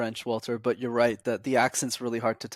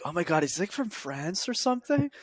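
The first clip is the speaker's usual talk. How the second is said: mock-amazed, pitch leaping higher at the end; amazed American; 7 semitones higher than usual. Imitating impressed Americans hearing him speak French, mock amazement. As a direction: ham up a starstruck American's surprise. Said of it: "people are like".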